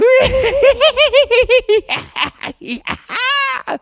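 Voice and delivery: high, loud shrieking witch cackle. Pretend. Ends witch impression laughing.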